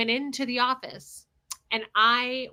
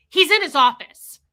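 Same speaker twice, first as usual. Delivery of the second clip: rude; 5 semitones higher, 11 dB louder than usual. Retold or imitated. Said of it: "I was so rude to her".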